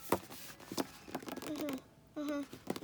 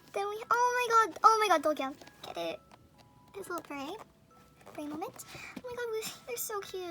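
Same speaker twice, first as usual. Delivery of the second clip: usual speaking voice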